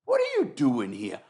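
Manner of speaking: high, slow, gushing judge greeting; imitating the judge. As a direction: fawn over him like a delighted fan. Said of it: "The judge goes".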